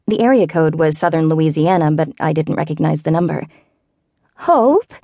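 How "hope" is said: put-on high, wavering old-lady falsetto. A narrator's reading. Elderly neighbor calling Hope on the phone, wavering falsetto. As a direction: quaver the name out high like a frail old neighbor. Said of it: "said a wavering falsetto".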